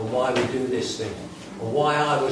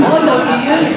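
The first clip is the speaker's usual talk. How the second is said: loud, high, disbelieving question; incredulous shout; 10 semitones higher, 10 dB louder, faster than usual. Retold or imitated. To his sober siblings, asking why they don't use, in a loud incredulous shout. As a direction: hurl the question at them half-laughing.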